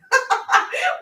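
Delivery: short high-pitched burst of laughter; high giggle. Real, not acted. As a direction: laugh at her own joke. Laughing at her own joke.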